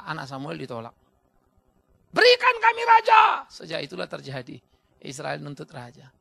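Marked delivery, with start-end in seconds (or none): 2.1-3.5 high, slow, loud shout; shouted demand. Acted. Israelites demand a king, slow high loud shout. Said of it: "Orang Israel datang".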